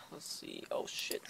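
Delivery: whisper. Real, not acted. Caught showing wrong thing.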